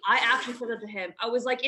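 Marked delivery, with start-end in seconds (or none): none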